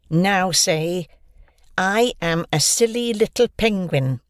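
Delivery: mocking bully. Bully taunting his victim.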